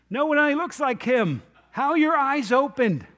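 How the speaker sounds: high, loud, insistent challenge; acted incredulous questioning. Neighbours question the healed blind man, high incredulous challenge. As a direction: fire the questions out loudly and high.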